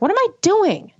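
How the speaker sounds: high, startled self-question; catching herself. Retold or imitated. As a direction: suddenly realize the silliness. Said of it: "And I'm like".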